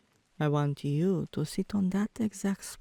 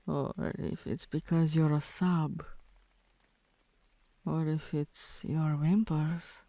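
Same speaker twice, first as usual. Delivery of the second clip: normal talking voice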